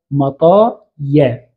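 مطايا drawn out slowly, ending in long -yaa; final derived form. Shown as a demonstration. Teacher giving the resulting plural after the changes, slow sounding-out. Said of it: "فنقول ماذا؟".

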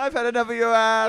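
high, loud hammed-up snap; mock fury. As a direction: snap like a betrayed diva. Mock diva fan shout-outs.

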